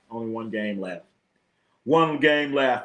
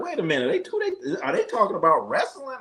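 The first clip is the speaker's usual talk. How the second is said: incredulous double take; 8 semitones higher than usual. Retold or imitated. Retold reaction to wrestling segment.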